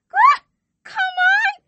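very high, loud burst of hype; shrieked cheer; hyped squeal. Real, not acted. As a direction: squeal it out, thrilled. Mock hype squeal about the event.

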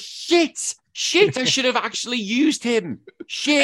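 high, loud panicked swearing; a player realising too late, cursing; panicked gamer. Retold or imitated. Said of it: "Everyone's like".

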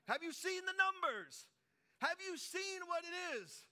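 loud, high, drawn-out protest; incredulous outcry. Acted. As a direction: cry out in mock alarm at the odds. Panicked servant protesting the odds.

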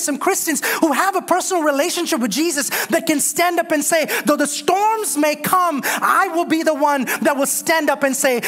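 high, ringing preacher's call; rally the church to stand firm; full-force preaching. Acted.

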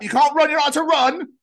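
put-on high, loud childish shout; playground shout. Kid calling out while racing a friend, shouting. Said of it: "You're like".

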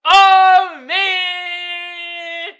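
loud, high "oh man" outburst; big high-pitched holler; amused outcry. Real, not acted. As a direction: shout it, drawn out and high. Laughing fit over a picture.

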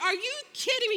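high, loud disbelief; incredulous outburst. Retold or imitated. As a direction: challenge him, appalled and playing it up. Retold disbelief at husband's plan.

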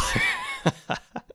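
high shouted laugh; laughing at a silly call name; burst of laughter. Real, not acted. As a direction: crack up at the call's name.

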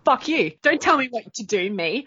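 high, loud mock defiance; defiant retort. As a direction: snap it back like a rebel. Rebel defiance to herself.

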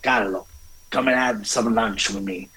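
ordinary talk, nothing marked

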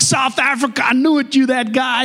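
high, thrilled American fan voice; excitable bank teller. Acted. Teller recognizing the man from the movie, excitable. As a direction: burst out in delighted recognition. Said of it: "there's this excitable, um, teller".

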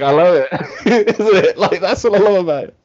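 giddy, high-pitched laughing enthusiasm; laughing delight. Real, not acted. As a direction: laugh while gushing. Delighted, laughing at own remark.

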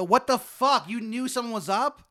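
high, loud, furious shout; loud high angry yell; angry outburst. Acted. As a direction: yell it at the doctor in disbelief. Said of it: "I go".

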